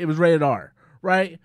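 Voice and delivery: normal talking voice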